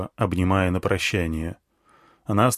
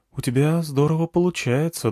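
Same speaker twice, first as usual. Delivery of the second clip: woman's voice; 6 semitones higher than usual. Acted. Snake woman speaks.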